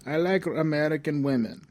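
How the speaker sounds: mock Yakov Smirnoff delivery; Russian accent. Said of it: "talked in this Russian accent".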